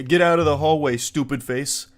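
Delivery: loud, mocking schoolyard taunt; mock bully yell at a classmate; cartoon bully. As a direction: shove the words like a playground bully. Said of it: "a cartoonishly douchey bully".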